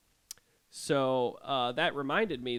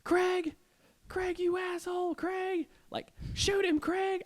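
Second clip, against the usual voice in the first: high-pitched mock scream of a frantic gamer; imitated screaming; slower than usual. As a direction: scream it high, imitating someone yelling into game chat. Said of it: "He's screaming".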